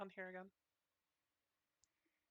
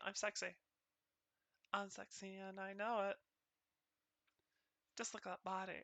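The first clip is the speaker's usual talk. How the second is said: mock sultry; 2 semitones lower, 12 dB quieter than usual. Pretending to be sexy.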